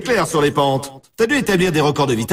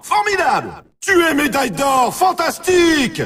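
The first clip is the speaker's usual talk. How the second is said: high-pitched, shouted victory announcement; thrilled game announcer, voice pitched way up; ecstatic congratulations; 10 semitones higher than usual. Acted. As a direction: cry it out like an announcer crowning the champion. Announcing silver and gold medal wins.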